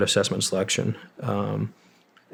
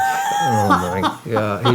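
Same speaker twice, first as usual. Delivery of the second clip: shocked exclamation; much slower than usual. Retold or imitated. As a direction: blurt it like a medic who can't believe his eyes. Corpsman stunned by the request.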